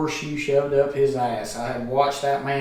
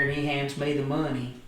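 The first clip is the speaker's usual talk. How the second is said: ordinary delivery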